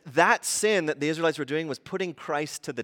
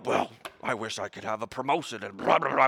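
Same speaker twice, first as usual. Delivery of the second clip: low mock mutter; discontented muttering, acted out; grumbling mutter; 3 semitones lower than usual. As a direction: mumble a put-on gripe, trailing into babble. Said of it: "we just grumble and mutter".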